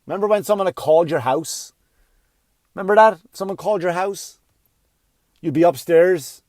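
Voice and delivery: ordinary delivery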